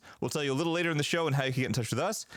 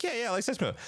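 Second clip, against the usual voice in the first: eager yes; 10 semitones higher, faster than usual. Retold or imitated. Self quoted eagerly to server.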